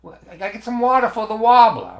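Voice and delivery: loud, high goofy voice; mock wacky voice. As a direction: ham it up like a loon asking for water. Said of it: "like it escaped mental patients".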